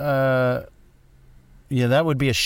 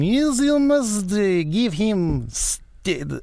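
mock Slavic accent, much higher and slow; hammed-up Slavic accent, dragged out; bad Slavic accent, pitched way up and slowed down; Stalin in a terrible Slavic accent; 9 semitones higher, much slower than usual. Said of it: "why did I do a terrible Slavic accent".

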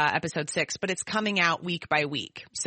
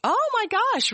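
high, astonished "oh my gosh"; real surprise, the pitch shooting up; surprised gasp; 3 dB louder than usual. Real, not acted. Startling fact about Reese Witherspoon.

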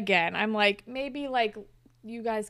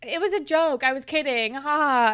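raised, louder retold protest; higher, emphatic backpedaling; mock defensive; 4 semitones higher, 7 dB louder than usual. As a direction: play a girlfriend insisting it was a joke, loudly. Retold defensive girlfriend in quarrel.

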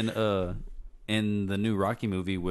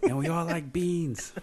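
much higher, slow put-on local voice; high slow mocking Boston voice; 10 semitones higher, 3 dB louder, slower than usual. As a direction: tease Bostonians in a drawn-out caricature. Joins the Boston mockery.

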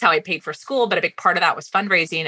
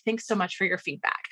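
softer, tight-lipped thanks; a softer, restrained thank-you; forced politeness; 9 dB quieter than usual. Retold or imitated. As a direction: swallow the anger and stay polite. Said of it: "bit my tongue".

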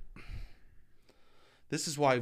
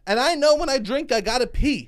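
excited; 11 semitones higher, 5 dB louder than usual. Pretend. Hammed enthusiasm.

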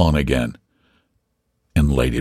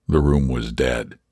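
ordinary delivery, nothing marked

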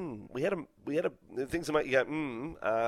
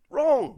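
"Wrong" barked high and loud; 12 semitones higher, 4 dB louder than usual. Real, not acted. Host declaring the deal wrong, loud and high. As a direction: slam "Wrong" down hard. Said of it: "It is so wrong".

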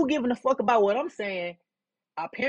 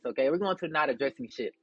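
ordinary talk

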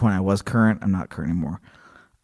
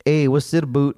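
mock Canadian accent; 5 semitones higher than usual. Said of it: "Canadian accent".

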